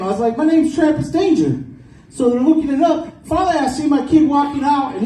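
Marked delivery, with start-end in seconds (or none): 0.4-1.6 his own voice pushed way up; high-pitched self impression. Comic retelling his school pickup, squeaky high self voice. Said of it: "It's like three octaves higher".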